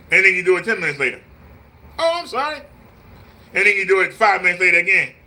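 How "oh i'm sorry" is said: high, airy fake sorry; mock apology. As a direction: toss off a hollow apology. Mock apology quoted repeatedly.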